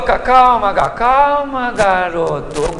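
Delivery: very slow, stretched-out "calma"; long soothing vowels, sinking at the end; calming the boy. Acted. Eliseu steadying the panicked boy, slow and soothing. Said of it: "Eliseu olhou e falou".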